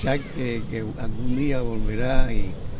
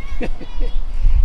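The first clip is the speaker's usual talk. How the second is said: a real laugh, loud and open; hearty laugh; 6 dB louder than usual. Real, not acted. Married man laughs about his wife to the host, loud and real. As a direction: laugh out loud at your own joke.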